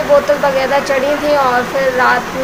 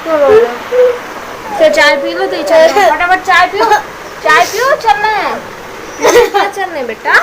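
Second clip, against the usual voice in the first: higher, sing-song coaxing; 6 semitones higher than usual. Real, not acted. Aunt coaxing her crying nephew to drink tea, higher.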